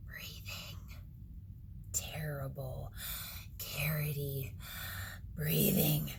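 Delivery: slow, hushed creepy whisper; eerie whisper. A narrator's reading. Narrating the scary breathing in a whisper. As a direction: let it creep in slowly.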